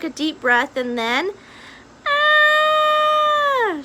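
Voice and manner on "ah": high loud shriek of terror; scream. A narrator's reading. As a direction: shriek in fright. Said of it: "she screamed again".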